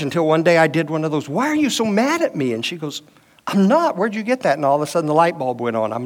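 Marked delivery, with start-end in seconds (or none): 1.3-2.5 raised-pitch, plaintive "why are you so mad"; mock hurt question. Husband asking wife why she's mad, higher, mildly hurt. 3.5-4.5 higher, surprised "I'm not"; mock surprised denial. Wife surprised at husband's question, higher pitched mock surprise.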